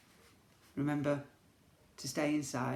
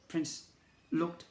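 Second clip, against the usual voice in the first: usual speaking voice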